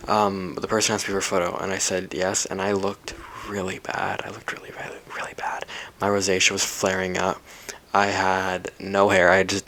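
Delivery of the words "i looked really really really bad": hushed confessional whisper. Real, not acted. Vlogger to viewers, whispering in embarrassment. Confessing she looked bad.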